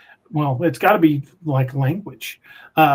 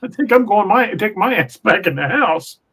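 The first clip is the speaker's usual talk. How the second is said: comic retreat in a higher voice; imagined chicken owner retreating; 6 semitones higher than usual. Retold or imitated. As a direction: pitch it up, beating a mock hasty retreat. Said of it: "I'd been like".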